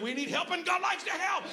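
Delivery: forceful, rising proclamation; emphatic repeat. Real, not acted. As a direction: drive it home to a congregation that missed it. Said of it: "You're not catching me. I said".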